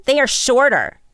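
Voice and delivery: put-on high, loud kid calling out; child's shout. A narrator's reading. Child announces a finding about the guests, shouting. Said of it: "one shouted".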